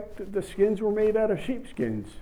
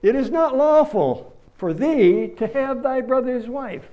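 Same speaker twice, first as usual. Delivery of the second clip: loud, high prophet's rebuke; preaching hard; 7 semitones higher, 4 dB louder than usual. Retold or imitated. John the Baptist loudly rebuking Herod for taking his brother's wife. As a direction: thunder it at the king like John. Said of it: "John preached the gospel hard and long".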